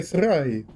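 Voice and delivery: "thrive" with a trilled R; rolled R. Shown as a demonstration. Showing an affectation, rolled R in "thrive". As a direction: roll the R hard in "thrive". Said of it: "English doesn't generally roll R's".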